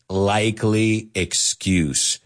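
likely excuse, said slowly and deliberately; slow, drawn-out. Shown as a demonstration. Teacher modelling a phrase for students, slow and clear. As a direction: stretch out likely excuse for the learners. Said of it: "As we say in English".

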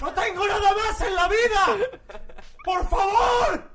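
hammed-up, high-pitched screaming; imitated desperate viewer. Mimicking a viewer with nothing else in life, desperate scream. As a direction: scream it like a desperate fan, stretching the final plea. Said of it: "esa persona que tú has imitado".

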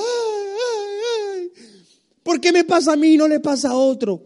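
mock crying. Preacher imitating himself as a sick boy, mock crying. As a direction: whimper like a sick child. Retelling his childhood pain crying.

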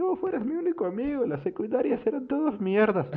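put-on very high, gushing drunk voice, laughing between lines; drunk sentimental gush. Drunk player tells his old rival he cried for him, high and gushing. Drunk protagonist confessing friendship.

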